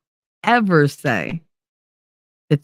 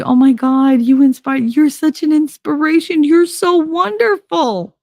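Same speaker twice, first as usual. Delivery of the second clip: gushing admirers; 12 semitones higher than usual. Retold or imitated. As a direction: play the dazzled fans, oozing. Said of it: "They see".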